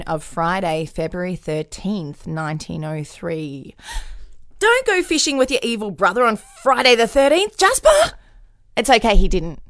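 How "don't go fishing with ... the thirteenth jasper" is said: loud, high warning call; warning shout. Pretend.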